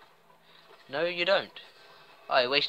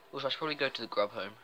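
normal talking voice

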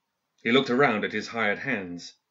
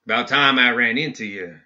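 lawman's slow prairie drawl; 5 dB louder than usual. Acted. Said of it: "he said in a prairie drawl".

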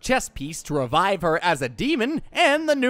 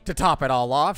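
ordinary talk, nothing marked

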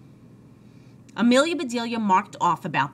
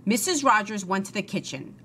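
usual speaking voice

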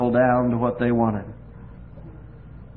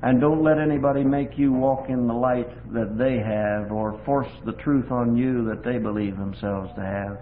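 ordinary talk, nothing marked

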